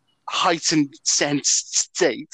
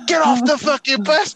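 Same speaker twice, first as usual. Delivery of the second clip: raised, high-pitched imitation of a driver kicking off; mock angry driver; 10 semitones higher, 5 dB louder than usual. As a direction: bellow it like a fed-up bus driver, retold. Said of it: "kicking off, goes".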